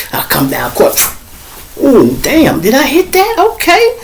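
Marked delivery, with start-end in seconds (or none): 1.8-4.0 surprised delight. Retold or imitated. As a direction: pitch jumps much higher. Surprised at own shot.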